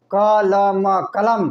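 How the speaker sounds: क, ल, म spelled out slowly, each sound given its full vowel; slow spelled syllables. Shown as a demonstration. Teacher spelling a word aloud to students, slowly. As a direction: sound out क ल म one by one, then blend into कलम. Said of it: "क का पूरा उच्चारण".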